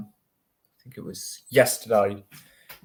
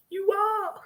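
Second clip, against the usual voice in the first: much higher, put-on insistent American voice; mock American insisting. American stranger insisting again, much higher. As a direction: squeak it out, stubbornly sure.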